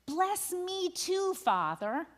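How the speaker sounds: slow, rising plea; anguished cry. A narrator's reading. Esau cries out to his father, slow anguished plea. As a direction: draw out the cry with a lift. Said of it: "he says in anguish, he cries out".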